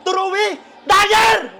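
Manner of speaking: high, loud, drawn-out call; stretched hopeful shout as it flies; excited shout. Real, not acted. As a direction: yell out the dagger shot. Calls the decisive shot.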